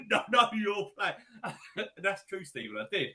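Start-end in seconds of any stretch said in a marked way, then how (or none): 0.1-1.9 slow, higher, laughing repeat; laughing echo. Real, not acted. Echoing his co-host's jibe while laughing, slow and high. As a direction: giggle the phrase back at the joker.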